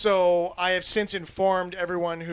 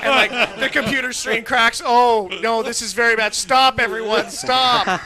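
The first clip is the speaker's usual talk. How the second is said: louder, higher frantic cries; mock panic as things break; hammed-up office chaos; panicked yell; 5 semitones higher, 7 dB louder than usual.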